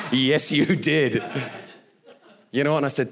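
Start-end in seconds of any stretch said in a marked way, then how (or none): 0.1-1.2 drawn-out amused dad. Retold or imitated. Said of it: "And I'm like".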